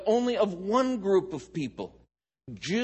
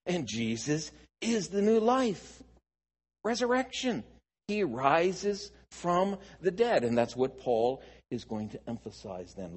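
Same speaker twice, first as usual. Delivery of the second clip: ordinary talk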